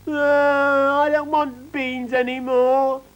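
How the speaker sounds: high, loud, slow put-on wail; fake crying child. A narrator's reading. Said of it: "she started to cry".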